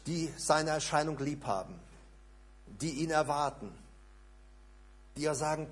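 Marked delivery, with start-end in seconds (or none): none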